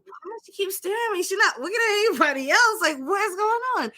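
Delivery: high, fast puzzled inner voice; inner confusion. Retold or imitated. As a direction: wonder it to yourself, high and quick. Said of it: "And I was like".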